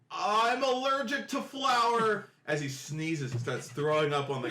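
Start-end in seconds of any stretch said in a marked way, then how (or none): 0.1-2.3 high, slow pitiful whine; whiny gnome. Acted. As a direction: whine it out like a sick little gnome. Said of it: "And one guy goes".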